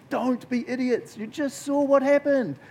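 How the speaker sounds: high, slow, drawn-out mock warning; exasperated prophet. Prophets warn Judah after Israel's fall, high exasperated plea. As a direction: stretch each word out high, pleading with fools. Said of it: "sends a whole bunch of prophets saying".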